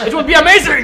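loud high burst of excitement; excited. Real, not acted. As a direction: shout it out thrilled. Excited cheer about level idea.

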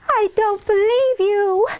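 high, wailing little-sister protest; upset disbelief. A narrator's reading. Henrietta to Kim from under pillow, upset protest. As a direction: wail it in a high child's voice.

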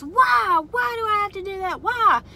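high, loud whiny protest; whiny yelling. Retold or imitated. Mom imitating her daughter Summer yelling, high and whiny. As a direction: mimic a teen shrieking back. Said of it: "Summer just starts raising her voice".

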